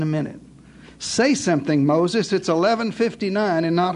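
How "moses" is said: ordinary talk, nothing marked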